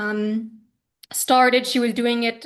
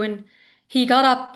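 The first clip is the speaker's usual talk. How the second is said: usual speaking voice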